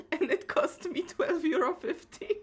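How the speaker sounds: real giggly confession; laughing. Real, not acted. Streamer confessing what the flying cat cost, laughing.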